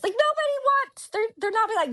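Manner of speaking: high-pitched, exasperated self-doubt; mock incredulous protest. Doubting any spirit would want her, mock incredulous protest.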